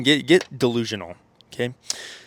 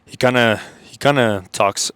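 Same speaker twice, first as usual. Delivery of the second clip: mimicking Hamza's low voice; 7 dB louder than usual. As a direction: lean into a slowed, weighty delivery, half-joking. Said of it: "Let's see if I can mimic him".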